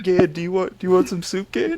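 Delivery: high, hammed-up coddling voice; doting carer. Carer offering soup to vegetative friend, mock coddling. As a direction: coax a helpless patient in mock concern.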